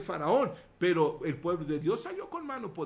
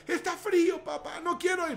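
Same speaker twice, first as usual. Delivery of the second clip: put-on whiny child; 8 semitones higher than usual. Hijo quejándose al padre.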